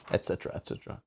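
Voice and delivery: whispered, tossed-off aside. Real, not acted. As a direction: breathe it away dismissively. Trailing off dismissively.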